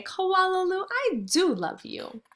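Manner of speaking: high, tender mother's voice; loving mother. Acted. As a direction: coo it to your little one. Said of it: "laugh and shake her head and say".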